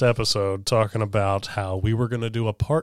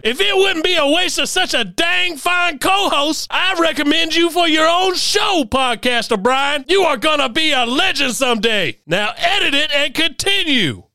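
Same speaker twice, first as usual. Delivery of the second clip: drill sergeant; 4 dB louder than usual. Acted.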